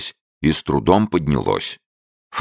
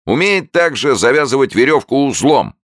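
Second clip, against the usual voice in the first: high, loud barker announcement; announcing showman; 12 semitones higher, 5 dB louder than usual. A narrator's reading. Showman announcing the newt's trick, high loud barker. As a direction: proclaim it to the crowd, pitched high. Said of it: "объявил человечек".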